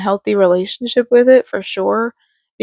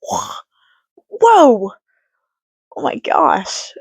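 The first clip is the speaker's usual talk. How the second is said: surprised; 5 semitones higher, much slower than usual. Retold or imitated. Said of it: "I was like".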